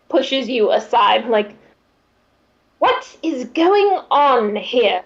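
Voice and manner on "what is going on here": higher, slow, indignant demand; Adamantia. Acted. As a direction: burst in demanding an explanation. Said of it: "Adamantia is going to ... bursts on the scene".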